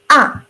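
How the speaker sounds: high "Ah" dropping hard like anger; sharp falling fourth tone. Shown as a demonstration. Teacher demonstrating the falling fourth tone with anger. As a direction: snap "Ah" down, a touch angry. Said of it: "pronounce like".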